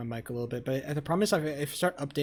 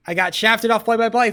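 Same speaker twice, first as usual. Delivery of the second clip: much higher and louder, a real furious outburst; furious yell; 6 semitones higher, 9 dB louder than usual. Real, not acted. Streamer yelling to chat about losing play-by-play, furious shout.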